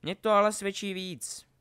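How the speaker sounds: high, loud, gloating retort; amused taunt. A narrator's reading. Opponent laughs at Harry mid-duel, amused taunt. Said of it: "Zasmál se mu protivník".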